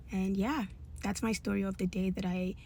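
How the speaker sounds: normal talking voice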